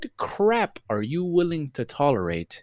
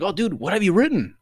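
put-on higher, eager kid's question; voiced eight-year-old; 8 semitones higher, 3 dB louder than usual. Eight-year-old self asking the grown-up, eager higher voice. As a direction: ask it like an excited child. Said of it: "you talk to your eight-year-old self".

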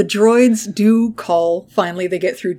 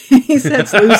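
higher, louder through a laugh; 4 semitones higher, 4 dB louder, much slower than usual. Real, not acted. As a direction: crack up mid-sentence.

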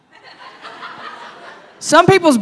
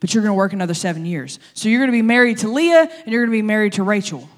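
rapid terms; faster than usual. Retold or imitated. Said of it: "Laban looks at him and is like".

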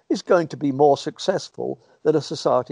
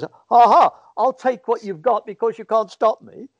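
jeering ha ha, pitched up and louder; mock the injured man with a cruel laugh; 6 semitones higher, 4 dB louder than usual. Mocking bully jeers at the man with a broken leg. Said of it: "everybody just laughs at you and says".

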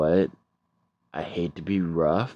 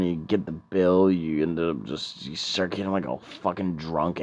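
ordinary talk, nothing marked

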